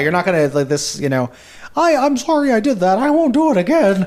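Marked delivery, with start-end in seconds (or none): 1.8-4.1 high, meek little apology. Retold or imitated. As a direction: squeak out a sheepish apology. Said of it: "He's, like, an old fisherman".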